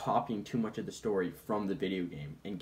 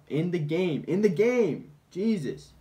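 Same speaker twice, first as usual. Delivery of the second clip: loud, high exasperated yell; exasperated shout; 8 semitones higher, 9 dB louder, slower than usual. Acted. Exasperated correction of a misunderstood remark.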